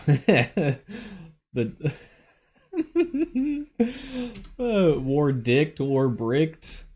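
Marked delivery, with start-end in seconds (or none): 2.7-4.5 squealing burst of laughter; high-pitched laugh. Real, not acted.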